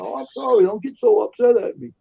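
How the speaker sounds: high, loud, simpering sorry; imitating a sheepish driver backing down; meek apology. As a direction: whine it like a caught tow driver.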